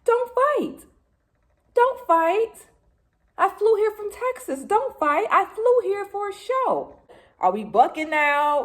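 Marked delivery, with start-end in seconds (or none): 0.0-7.0 very high tiny pleading voice; a tiny high voice pleading with the fighters to stop; little voice. Retold or imitated. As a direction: squeak it like a small child begging. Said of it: "my little voice".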